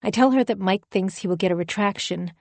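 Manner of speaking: plain reading voice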